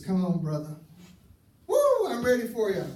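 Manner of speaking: high, loud, cocky taunt; hammed-up wife spoiling for a fight; mock fighting challenge. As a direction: square up to him, itching for a fight. Wife squaring up in argument.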